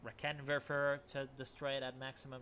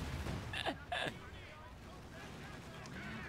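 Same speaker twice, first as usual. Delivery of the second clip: breathy laugh; 6 dB quieter than usual. Real, not acted. Laughing at self-destroying player.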